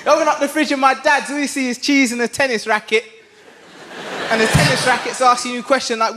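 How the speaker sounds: ordinary delivery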